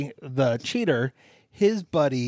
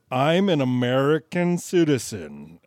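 over-careful imitation American accent; stilted American accent; 3 dB louder than usual. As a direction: mimic a Brit's laboured American accent. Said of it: "his accent was very like".

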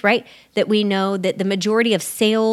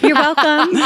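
laughing you're welcome; 7 semitones higher, 5 dB louder than usual. Real, not acted. Laughing mock bow.

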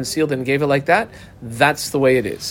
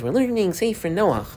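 lightly voiced little kid, higher pitch; little schoolboy; 5 semitones higher, 4 dB quieter than usual. Little Maishi telling what he learns in Cheder, lightly voiced schoolboy. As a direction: answer lightly as a young pupil would. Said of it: "So little Maishi says".

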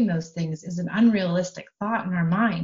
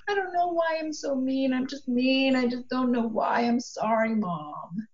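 high, small voice of a remorseful daughter; lightly voiced teen girl, pitched up and plaintive; daughter's voice; 6 semitones higher than usual. Mother imitating her daughter saying sorry, high and small.

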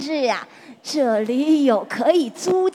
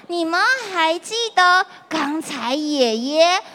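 put-on lilting, friendly voice for kids; reading to a toddler; 3 semitones higher, faster than usual. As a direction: talk to little children warmly. Quiz with children. (给小朋友讲故事的语气)

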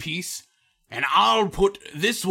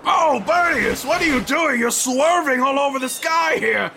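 alarmed shouting; 7 semitones higher than usual. Acted. Panicking in mop flight.

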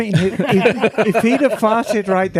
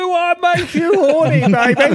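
high teasing flirt; high mock flirt; 11 semitones higher than usual. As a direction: coo it back teasingly.

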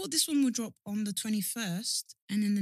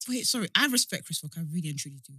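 ordinary delivery

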